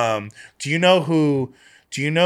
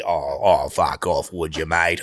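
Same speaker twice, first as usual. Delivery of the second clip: very low, exaggerated Australian; deep mock-Australian put-on voice; deep Aussie voice; 8 semitones lower, slower than usual. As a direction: drop right down into a broad Aussie bloke. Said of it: "I was just doing this deep".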